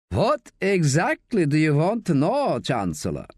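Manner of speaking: high-pitched mock German interrogator; mock German accent. Staff questioning Josie with the knife, mock German accent. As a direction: put on a playful German accent, dropping on the last word. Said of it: "in a mock German accent".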